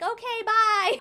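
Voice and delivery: put-on high, cheerful little kid; child voice. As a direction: chirp it like a small child. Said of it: "And she's like".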